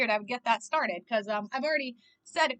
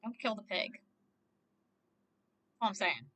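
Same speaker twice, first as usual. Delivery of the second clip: low, soft plea; subdued pleading; 6 semitones lower, 9 dB quieter, much slower than usual. Real, not acted. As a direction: plead quietly for the animal. Pleading for pig in the story.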